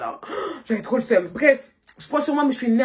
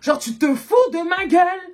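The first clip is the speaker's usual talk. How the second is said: retold anger; 5 semitones higher, 5 dB louder than usual. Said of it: "j'étais encore plus énervée".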